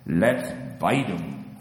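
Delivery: slow, low duck voice; lightly voiced duck; quacking ducks. A narrator's reading. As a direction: quack the words out slowly. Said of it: "quacked the ducks".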